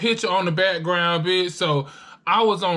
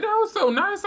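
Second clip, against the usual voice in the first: much higher, delighted gush. Retold or imitated. To the friend about her bus ticket, a high delighted gush. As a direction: gush over the kind gesture. Retold gushing reply.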